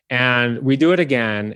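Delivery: ordinary talk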